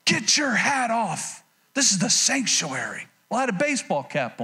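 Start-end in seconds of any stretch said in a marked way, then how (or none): 0.0-3.1 slow, stern old man scolding, lightly voiced; grumpy old man. Old man scolding a boy in church, mock stern. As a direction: drag it out like a cranky elder. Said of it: "he barked at me".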